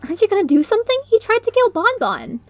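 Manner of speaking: high urgent pleading; frantic. Acted. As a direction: urge them on in alarm. Lyra demands action.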